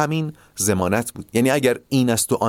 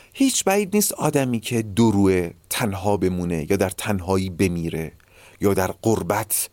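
ordinary talk

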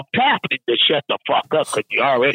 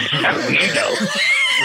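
high howl of laughter. Real, not acted. After his own bus joke to friends, a high laughing howl.

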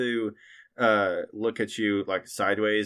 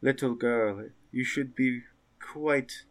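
witch; 3 semitones higher, 5 dB quieter than usual. Acted. Witch leans in and says.

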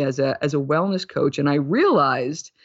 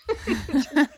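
high bright laugh at herself; 9 semitones higher than usual. Real, not acted. Alone in her kitchen, laughing at herself.

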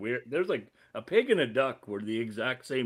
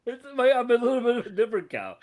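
laughing defense; 11 semitones higher, 4 dB louder, faster than usual. Real, not acted. Defending his cow, laughing.

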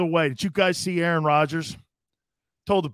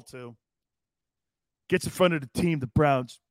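ordinary talk, nothing marked

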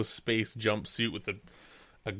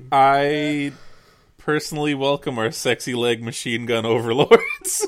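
loud, laughing confession; laughing while owning up; 4 semitones higher, 7 dB louder than usual. Real, not acted. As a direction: giggle through it.

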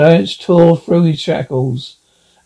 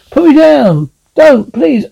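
much higher, panicked begging; frightened pleading; 10 semitones higher, 4 dB louder than usual. Acted. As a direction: beg to be let go. Woman seized by the monster.

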